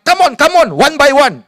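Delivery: high, loud schoolyard taunt; taunting call. Retold or imitated. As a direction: egg him on to fight. Schoolyard fight challenge called out. (ejekan kuat budak sekolah)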